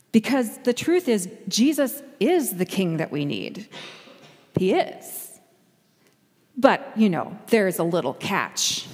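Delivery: usual speaking voice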